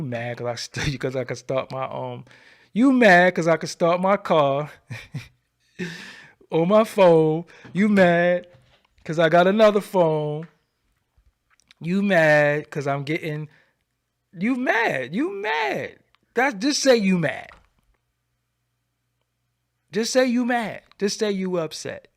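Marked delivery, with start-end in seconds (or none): 2.7-13.5 loud, drawn-out teasing of the haters; taunting. Real, not acted. 14.4-22.1 high, loud mocking jabs; taunting. Real, not acted. Mocking haters as mad.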